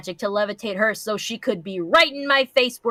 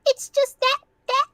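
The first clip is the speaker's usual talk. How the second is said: pony voice; 4 dB louder than usual. Acted. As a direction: whine it like a cartoon pony. Voicing character in fanfic reading.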